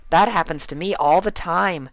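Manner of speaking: very high, bubbly cartoon voice; squeaky, excitable pony; Pinkie voice. A narrator's reading. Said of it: "nodding like a schoolteacher".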